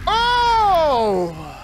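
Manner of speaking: drawn-out falling "oh"; long relieved groan. Real, not acted. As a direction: let out one long sliding cry. Long cry after fight.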